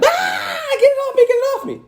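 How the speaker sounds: high laughing mock shriek, fast; mock panicked scream. Acting out fleeing a spider, high laughing mock shriek. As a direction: shriek and flee, hammed up. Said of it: "Or they run".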